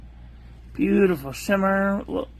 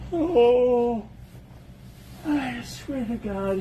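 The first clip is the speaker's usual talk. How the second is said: yawning; 5 semitones higher, much slower than usual. Real, not acted. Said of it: "if I never yawned again".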